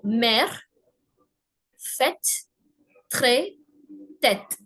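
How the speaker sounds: mère, fête, trait, tête with an open "eh"; French model words one by one, open "eh" vowel; open è sound. Shown as a demonstration. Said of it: "the sound is eh".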